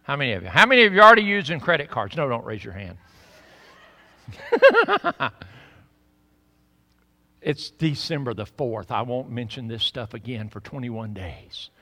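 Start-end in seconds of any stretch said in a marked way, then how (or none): none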